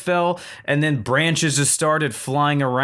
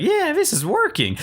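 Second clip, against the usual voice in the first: high-pitched mock excitement; hammed-up high glee; gleeful self-mockery. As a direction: squeal it like a pleased little kid. Imitating own pleased self while scraping.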